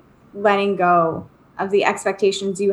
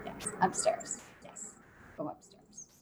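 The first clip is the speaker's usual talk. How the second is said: whispered aside; 20 dB quieter than usual. Real, not acted. Mom answering her kid during a call, real whisper. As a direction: whisper so the call can't hear.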